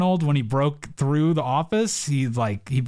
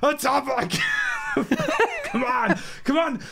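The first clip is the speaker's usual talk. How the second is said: put-on Irish accent; 14 semitones higher than usual. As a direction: shout it like a cartoon Irish leprechaun. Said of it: "[name] wants to do his Irish accent".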